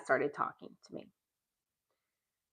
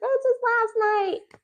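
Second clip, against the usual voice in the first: mock Crystal; faster than usual. Imitating Crystal's gushing reply.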